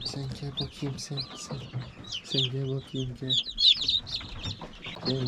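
soft, almost whispered calling; hushed coaxing. Real, not acted. Owner softly coaxing a chick to come closer. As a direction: coax a chick over gently.

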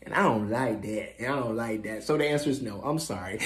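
low, soft, unbudging; hushed, deep put-down; cool refusal. Acted. Clerk coolly refuses rude customer.

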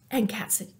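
plain reading voice

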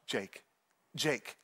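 low, soft urgent name-calling; hushed waking call. Retold or imitated. Wife waking her husband over a noise, hushed and low. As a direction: imitate waking someone in the dark.

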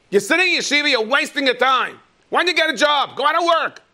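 high, loud angry shout at his son; yelling father. Retold or imitated. Father yells at son sitting in yeshiva, angry shout. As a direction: blast him for wasting time. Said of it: "this man yells at his son".

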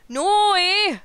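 high, loud Canadian "No, eh?"; mock Canadian. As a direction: ham up the Canadian "eh". Player voicing Canadian character.

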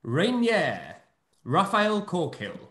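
ordinary talk

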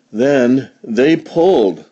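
ordinary delivery, nothing marked

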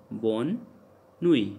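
"nuit" said as "nwee", no T; silent final T. Shown as a demonstration. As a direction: leave the T off the end of "nuit". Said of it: "T never pronounced in the ending".

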